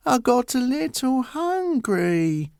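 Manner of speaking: put-on high, slow, childish giant voice; a big lad owning up meekly; high childlike giant, slow and sheepish; sheepish giant son.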